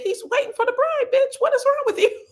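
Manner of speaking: very high, loud exasperated snap; exasperated mother. Retold or imitated. Trina scolding clueless Toni at the wedding, high exasperated snap. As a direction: snap at her like a fed-up mother. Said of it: "Trina said".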